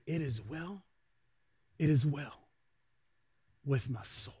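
gentle hymn recitation, slowing down. Acted. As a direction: recite the hymn like a hushed prayer. Quotes hymn softly.

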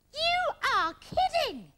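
high, indignant telling-off; angry scolding. Acted. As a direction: threaten him through a closed door, pitched high. Scolds Sooty through bathroom door.

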